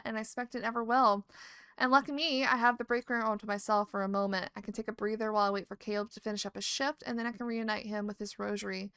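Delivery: usual speaking voice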